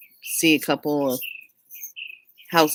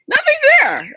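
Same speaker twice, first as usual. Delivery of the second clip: high, louder cry of nothing there; shocked disbelief; 5 dB louder than usual. Retold or imitated. Telling sister in the kitchen the dog is gone, with a high shocked cry. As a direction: pitch jumps way up in disbelief.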